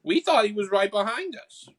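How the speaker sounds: high-pitched Boston sprite; squeaky-high character with a Boston accent. Acted. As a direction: make excuses in a high Boston voice. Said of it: "I'm stuck in the Boston accent".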